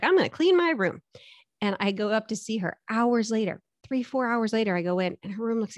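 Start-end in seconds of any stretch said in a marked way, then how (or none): 0.0-1.0 imitating daughter. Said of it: "And she's like".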